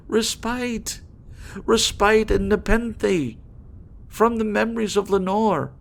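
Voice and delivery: high, loud, pleading outburst that slows at the end; impassioned cry. Acted. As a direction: beg the bird desperately. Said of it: "I cried".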